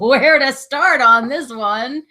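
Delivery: laughing through the words; loud, high laugh while talking. Real, not acted. Laughing at husband's question.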